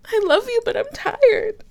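high, breaking into a cry; the anger collapses into tears; tearful. Acted. Breaking down after fight.

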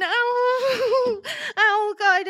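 high, crying yelp; acted sob of pain. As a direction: sob out as if something broke. Roleplay, mother hurt, crying out.

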